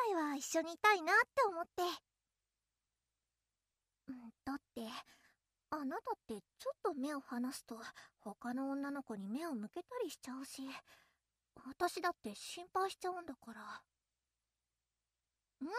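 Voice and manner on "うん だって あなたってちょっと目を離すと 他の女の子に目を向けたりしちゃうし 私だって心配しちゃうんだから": quiet. Acted. Childhood friend confessing worry softly.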